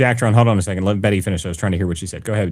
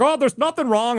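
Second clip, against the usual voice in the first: mocking DeWine; 12 semitones higher than usual. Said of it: "It's like".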